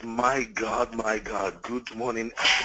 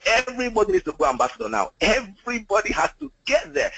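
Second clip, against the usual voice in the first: excited; 11 semitones higher than usual. Real, not acted. Thrilled call to action.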